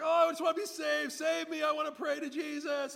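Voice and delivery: high, loud mock pleading; over-the-top yelled plea; imitated yelling. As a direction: wail it out desperately, hammed-up. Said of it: "he's, like, yelling out".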